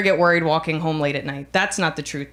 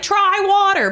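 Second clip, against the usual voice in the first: high-pitched offhand yell; mock shout; 12 semitones higher, 4 dB louder, slower than usual. Woman walking home answering a stranger, mock shout. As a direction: toss advice out as a raised-voice call. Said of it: "I might shout, like".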